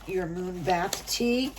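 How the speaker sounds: ordinary delivery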